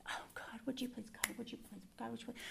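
breathless whispered plea to God; desperate whispered pleading; whispered begging prayer. Retold or imitated. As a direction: beg God in a hushed whisper, over and over. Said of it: "basically beg God".

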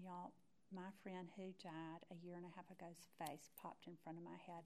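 ordinary talk

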